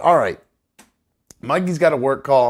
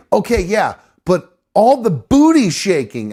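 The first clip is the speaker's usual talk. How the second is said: higher, louder mock mom, slowing at the end; imitating mom; 8 semitones higher, 7 dB louder than usual. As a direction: play his mother drawing out the complaint. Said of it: "And she goes".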